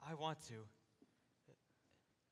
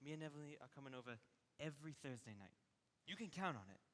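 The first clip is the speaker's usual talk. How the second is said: gentle reassurance; 5 dB quieter than usual. Acted. Son reassuring his crying mother.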